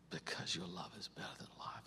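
reading the verse in a hushed whisper. Shown as a demonstration. Showing her morning devotion, whispering the Psalm to God. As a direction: breathe the psalm out softly, almost unvoiced. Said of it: "I'll read a few".

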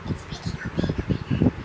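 hushed, voiceless murmur; barely audible, awestruck; awed whisper. Real, not acted. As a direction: whisper it under your breath. Nervously opening the package.

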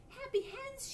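very high pleading point. A narrator's reading. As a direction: point at the words, pitch way up. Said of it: "she said".

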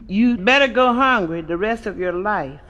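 usual speaking voice

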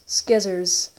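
"Skizzers." with a hard k after the s; own pronunciation. Shown as a demonstration. Gamer repeating his word for scissors, "Skizzers." with hard sk. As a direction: say "Skizzers." starting with sk. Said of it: "I call scissors skizzers".

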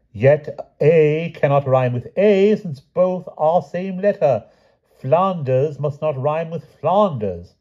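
high, slow, insistent lecturing; pedantic knight. A narrator's reading. Sir Palomides corrects the king's rhymes, high and pedantic. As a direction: spell it out in a high, drawn-out voice.

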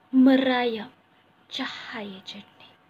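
normal talking voice

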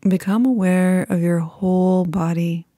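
calm, unhurried meditation voice with long pauses; guided meditation. Acted.

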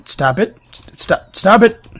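rising irritated yell at the game; annoyed stop it. Real, not acted. Gamer yelling at the game to stop. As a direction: snap at the screen to stop. Game keeps interrupting him.

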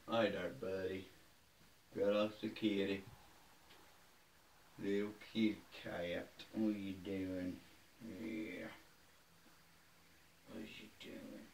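quiet, cooing voice to a cat; soft pet talk. Real, not acted. Talking to the kitty cat.

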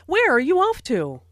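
Where are you off to? falling at the end; friendly Where are you off to? with a falling tune; falling question. Acted. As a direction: let the question drop, asking for information. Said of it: "Notice the falling intonation".

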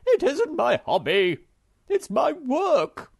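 high, loud, slow indignant snap; rude retort. Acted. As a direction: correct him with offended pride. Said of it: "retorted the Hemulan rudely".

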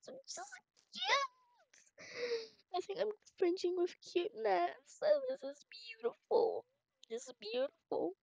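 very high squeal of delight over the dress; gushing squeal. Acted. Bride seeing her wedding dress, gushing very high squeal. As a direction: squeal like it's too cute to bear.

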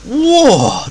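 high, loud falling whoa; amazed by the camera swing; surprised whoa. Real, not acted.

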